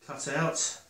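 ordinary delivery

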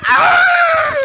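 loud, high scream; high burst of overexcited screaming; excited scream. Real, not acted. Overwhelmed by the new update.

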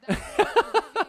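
a sudden high burst of laughter; high amused laugh. Real, not acted. Commentator cracks up at the awkward paddle tap, laughing high.

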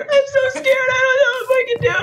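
high, crying panicked soldier; scared soldier. Pretend. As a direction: squeal it out terrified and near tears.

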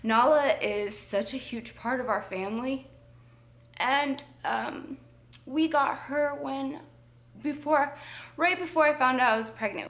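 real choked-up voice, pitch climbing; holding back tears. Real, not acted. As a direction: fight off crying as you go on, with halting pauses. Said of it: "I'm gonna try not to cry".

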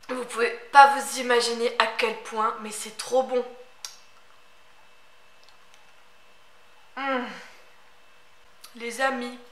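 talking between bites; savouring while eating; mouth full while chewing. Real, not acted. Seen 0: Holds and bites round pastry, chews between words throughout.

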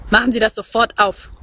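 usual speaking voice

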